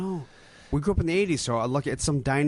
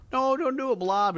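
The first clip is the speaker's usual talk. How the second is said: high panicked cry; panicked plea; 9 semitones higher than usual. Retold or imitated. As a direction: beg in a high voice. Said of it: "it's like".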